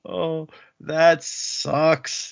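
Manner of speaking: ordinary talk, nothing marked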